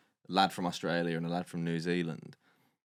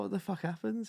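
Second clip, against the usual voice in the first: shocked demand. Retold or imitated. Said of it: "We're like".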